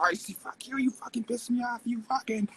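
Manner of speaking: high mock outburst at a rival; hammed-up pissed-off tirade; mock angry rant. Mocking how he'd rant at his rival, high and heated. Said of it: "I'm not gonna be always sitting there like".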